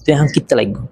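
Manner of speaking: তুই এখন কিত্তা লাগুন in Chittagonian speech; চাটগাঁইয়া উচ্চারণে; Chittagonian dialect. Shown as a demonstration. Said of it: "চট্টগ্রামের ভাষণ হবে".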